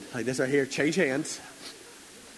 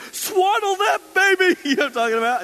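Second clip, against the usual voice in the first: Vince Vaughn; 7 dB louder than usual. Retold or imitated. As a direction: belt it out like a ham on stage. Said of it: "Vince Vaughn walks on stage and says".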